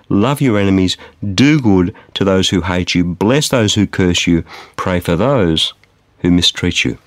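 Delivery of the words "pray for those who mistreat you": ordinary delivery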